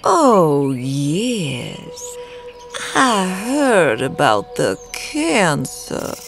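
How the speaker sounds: high, slow coo. A narrator's reading. Woman visitor needling Henry about his illness, cooing. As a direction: coo it with malicious sweetness. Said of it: "Delilah cooed".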